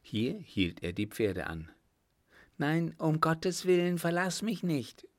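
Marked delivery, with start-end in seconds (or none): 2.6-5.1 high, urgent woman's plea; pleading. A narrator's reading. Woman begging Hugo not to leave her, high and pleading. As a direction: beg him in a raised, anxious pitch. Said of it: "bat sie".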